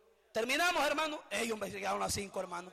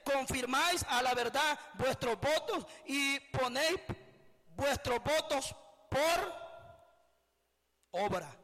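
preached scripture reading; 2 semitones higher, slower than usual. Acted. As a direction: proclaim the prophet's words to the congregation. Reads scripture aloud in preaching.

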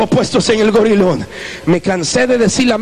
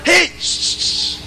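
shushing; 8 semitones higher, 4 dB quieter, much slower than usual. Retold or imitated. Shushing a crying crowd.

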